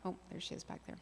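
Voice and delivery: breathy whisper under her breath; a quick hushed aside to herself. Real, not acted. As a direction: drop to a whisper as you spot her. Aside spotting Blanca in room.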